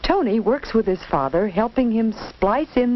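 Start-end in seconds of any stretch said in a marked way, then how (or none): none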